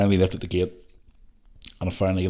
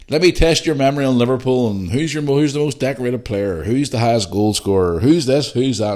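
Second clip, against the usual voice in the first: higher, louder rapid-question quiz, lightly acted; tipsy quizzing fan; 5 semitones higher, 5 dB louder than usual. Funny local lad testing his Liverpool memory, playful challenge.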